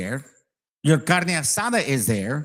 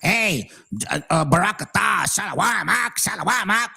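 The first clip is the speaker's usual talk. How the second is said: mock street-corner preacher; 4 semitones higher than usual. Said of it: "they're on a corner saying".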